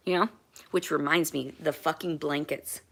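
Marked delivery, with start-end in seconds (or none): none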